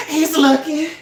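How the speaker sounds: high, loud thrilled whisper-squeal; excited gasp. Retold or imitated. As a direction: gasp that he's looking back. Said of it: "she's like".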